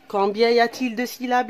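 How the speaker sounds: usual speaking voice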